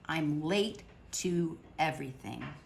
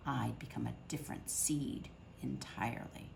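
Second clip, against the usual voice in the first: soft, unvoiced ominous whisper; dark whisper; 5 semitones lower, 10 dB quieter than usual. Dark turn in the story.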